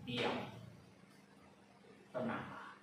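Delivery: soft, low, quiet rebuke; quietly commanding calm. A narrator's reading. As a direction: still the storm with a hushed word. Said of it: "menghardik angin itu dan berkata".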